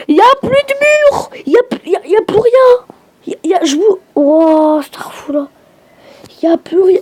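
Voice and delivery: cri très aigu et fort, incrédule; s'alarmer en découvrant la maison rasée; choqué. Real, not acted. Maison détruite dans le jeu. (stupeur aiguë devant la maison détruite)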